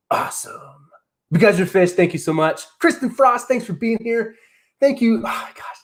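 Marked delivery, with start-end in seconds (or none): 0.1-0.9 breathy whispered word; whispered awe. Real, not acted. Aside while reading the chat. 5.2-5.8 whispered in disbelief; soft awed whisper. Real, not acted. Overwhelmed by the milestone.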